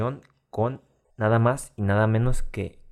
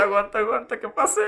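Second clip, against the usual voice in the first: much higher stifled giggle; trying not to laugh; 12 semitones higher than usual. Retold or imitated. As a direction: squeeze it out through held laughter. Holding back laughter at the scene.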